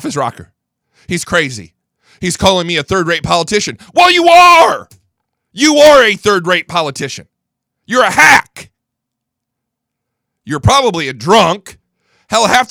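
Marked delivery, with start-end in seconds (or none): 3.9-7.3 sudden high, loud outburst; angry shout. Real, not acted. Radio host yelling at Pelosi, furious shout. 7.8-8.7 loud, high yell ending on a rise; angry shout. Real, not acted. Radio host insulting Pelosi, furious shout.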